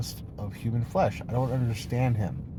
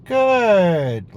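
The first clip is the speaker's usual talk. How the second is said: mock denial; 5 semitones higher, 6 dB louder, much slower than usual. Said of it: "you might even say".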